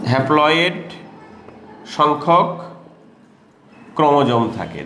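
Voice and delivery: each word spaced out for writing; slow dictation. Shown as a demonstration. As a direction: dictate word by word so they can copy. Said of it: "দেখো". (ধীরে ধীরে শ্রুতিলিখন)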